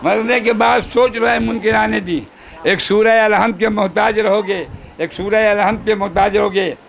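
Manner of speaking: measured, projected mushaira-style recital; poem recitation. Acted. Poet reciting praise verse to gathering, declaimed. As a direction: declaim the couplet, lingering on line endings.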